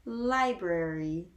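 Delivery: library stretched syllable by syllable; very slow, spread out. Shown as a demonstration. Saying it so learners can hear it, very slowly. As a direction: spread library out into long separate sounds. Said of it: "I will say it very slowly and spread out".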